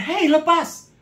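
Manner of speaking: high, sharp mock shout to let go; imitated angry pastor. Pastor trying to free his legs from the woman, high imitated angry shout. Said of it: "Pak Pendeta sampai marah".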